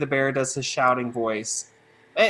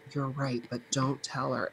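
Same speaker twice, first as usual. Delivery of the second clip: much softer, hushed confidential reply; secret aside; 4 semitones lower, 12 dB quieter than usual. Retold or imitated. Teacher covering puppet's ears to agree with student, hushed. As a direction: let the kid in on a secret.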